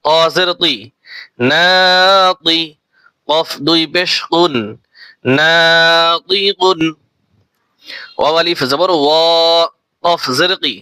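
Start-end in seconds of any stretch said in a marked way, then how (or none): 1.5-6.8 very slow, long stretched vowel; slow Quranic spelling blend; drawn-out blend. Shown as a demonstration. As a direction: stretch the alif one beat. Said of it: "এক আলিফ টান দিয়ে".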